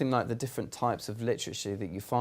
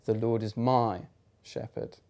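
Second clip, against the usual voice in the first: "my" pressed, then "shepherd" breathed out in a whisper; stress on my; slower than usual. Shown as a demonstration. Showing how to meditate, stress "my", whisper "shepherd". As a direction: lean on "my" and let "shepherd" fall away to a whisper. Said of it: "And then you could go".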